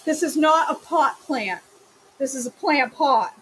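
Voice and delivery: mock-stern correction; emphatic correction. Shown as a demonstration. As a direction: set the record straight firmly. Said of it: "emphatic correction".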